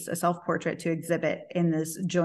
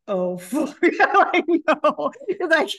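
laughing dismay; 9 semitones higher, 6 dB louder, much slower than usual. Retold or imitated. Panicked at the tripod.

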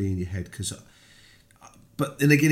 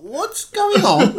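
high, loud, stretched-out question; alarmed caller; 8 dB louder, much slower than usual. Retold or imitated. Counsellor hearing fight noise down the phone, alarmed question. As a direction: imitate a caller's mock alarm, pitched way up.

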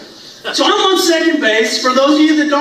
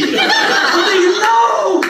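mock cry; 9 semitones higher, much slower than usual. Ball sails over his head.

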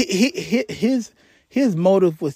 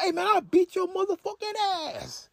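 mock threat; 12 semitones higher, 7 dB quieter than usual. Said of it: "So he's like".

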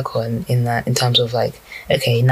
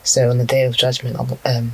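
usual speaking voice

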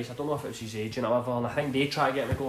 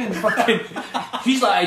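usual speaking voice

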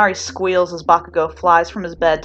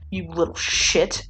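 growling; slower than usual. A narrator's reading. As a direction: drag it out slowly as a menacing growl. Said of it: "Bakugo growls".

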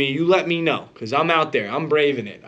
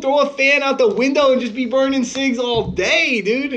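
high-pitched, incredulous pep talk; squeaky, worked-up mock scolding; mock exasperated coaching; 10 semitones higher than usual. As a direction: egg them on in hammed-up disbelief. Retells urging mom to up game.

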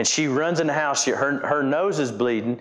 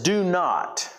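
"Do not" set apart, each word weighty; "Do not" as a firm, separate command; stress on DO NOT; 4 semitones higher than usual. Shown as a demonstration. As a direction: raise and punch "Do not". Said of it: "emphasize a couple of words".

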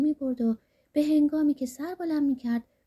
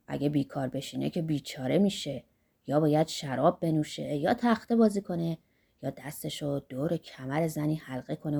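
put-on lightly lowered voice of an eager old man; Zorba's voice; 7 semitones lower than usual. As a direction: rub your hands over the gold, voice dropped low.

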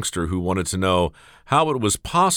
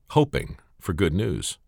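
ordinary delivery, nothing marked

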